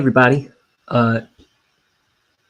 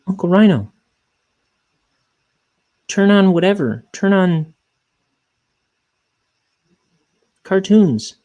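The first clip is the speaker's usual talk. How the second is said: high, louder mock-child voice; demanding little kid; 9 semitones higher, 5 dB louder than usual. Kids asking uncle to put on TV, imitated demanding voice. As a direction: imitate a kid calling out orders.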